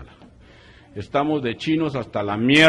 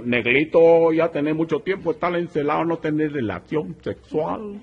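higher, coaxing put-on voice; mock Chinese coaxing; 6 semitones higher, 5 dB louder than usual. Chinese prisoner coaxes his cellmate, higher and sly. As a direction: sweet-talk him slyly.

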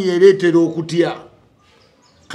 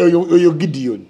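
ordinary talk, nothing marked

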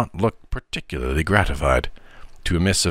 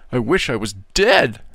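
put-on mild childish wail, pitched up; a boy's mock despair, voiced higher; crying out; 5 semitones higher than usual. A narrator's reading. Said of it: "cried the young gentleman".

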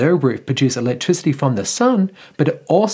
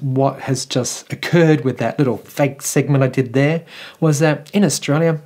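ordinary talk

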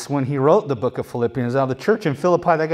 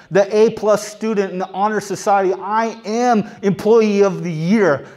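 raised, emphatic bragging voice; mock boasting; 6 semitones higher, 4 dB louder than usual. Preacher voicing Paul's résumé of righteousness, mock boasting. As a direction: reel off achievements with pumped-up pride.

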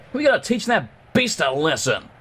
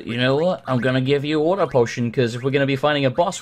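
normal talking voice

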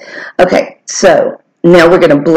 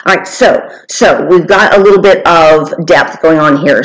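usual speaking voice

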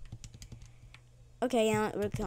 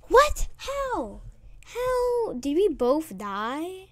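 shocked disbelief; 7 semitones higher, much slower than usual. Real, not acted. Shocked at dying.

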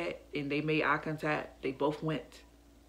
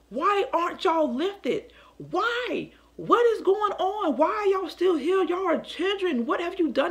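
much higher, pleading retold questions; distraught questioning; 11 semitones higher than usual. Mother asking her children why they aren't lifted, distraught. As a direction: plead it high, stretching the opening questions.

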